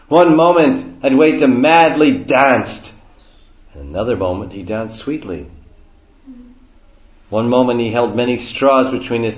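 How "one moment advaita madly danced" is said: loud, high, emphatic declaiming; dramatic exclamation. Real, not acted. Teacher telling of Advaita's mad dance, loud outburst. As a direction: burst out to match the mad dancing. Dramatically translating the wild dancing verse.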